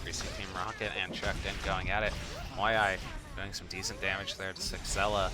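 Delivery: loud, energetic live game commentary; excited play-by-play. Real, not acted. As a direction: call the fight like a hyped caster. Excited esports play-by-play casting.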